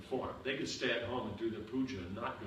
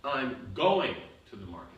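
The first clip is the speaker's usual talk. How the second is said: "going" lifted and louder, then falling; stress on going; 8 dB louder than usual. Shown as a demonstration. Teacher demonstrates shifting emphasis, hitting "going". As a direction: lean on "going" as if contradicting a denial. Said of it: "You said I'm not going, I'm going".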